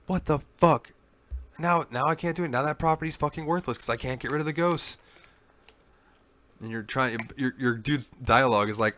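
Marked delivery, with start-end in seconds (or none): 0.1-5.0 higher-pitched imitation of a furious boss; hammed-up outrage, disbelieving; angry rant. Therese rages at the player about the locket, imitated fury.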